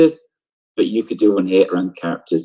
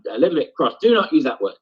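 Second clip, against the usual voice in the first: mild anger, pitched higher; 6 semitones higher than usual. Shown as a demonstration. Teacher demos John Tate turning from frustration to anger, mildly angry. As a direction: snap it out as the anger takes over. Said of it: "jump down to anger here".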